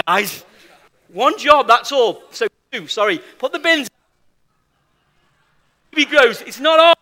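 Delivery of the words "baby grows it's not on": loud scolding. Real, not acted. Scolding crowd about task.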